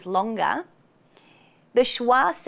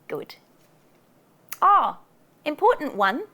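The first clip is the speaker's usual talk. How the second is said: ordinary delivery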